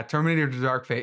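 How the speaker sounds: normal talking voice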